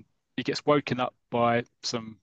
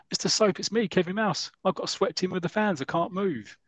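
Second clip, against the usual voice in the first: calling out; 8 semitones higher than usual. Retold or imitated. Player calling from the crowd.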